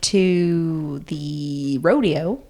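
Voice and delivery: drawn-out Texas twang, x0.5 pace; slow country drawl. Shown as a demonstration. Repeating the rodeo line in a slow country drawl. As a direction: stretch it like a Fort Worth cowgirl. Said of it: "everyone talks like this there".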